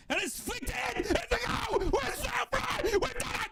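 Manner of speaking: high, screaming, stretched-out jubilation; real goal celebration. Real, not acted. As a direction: go wild, screaming yourself hoarse for the goal. Celebrating his team's goal.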